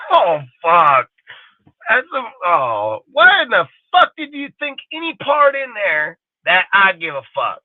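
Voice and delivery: real astonishment that cracks into laughter; incredulous laugh. Real, not acted. Ranting to his audience about fans who pay for dinner, laughing in high disbelief. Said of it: "I'm mind blown".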